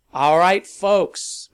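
loud, high rally call; addressing a crowd. A narrator's reading. Rally leader to his crowd through a headset, calling out. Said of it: "Malcolm said into his headset".

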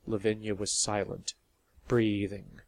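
ordinary delivery

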